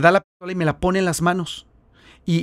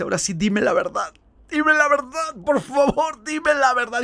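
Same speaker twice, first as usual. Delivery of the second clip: higher, pleading voice, begging for the truth; pleading through tears; 8 semitones higher than usual. Retold or imitated. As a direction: beg him to tell the truth, close to tears. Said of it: "y empieza a llorar". (súplica llorosa, más aguda)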